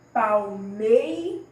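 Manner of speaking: "palmeiras" slowly, MEI loud and stretched; stressed-syllable demo. Shown as a demonstration. Teacher shows pupils the tonic syllable, stress on MEI. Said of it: "aquela sílaba que vem mais forte na nossa pronúncia".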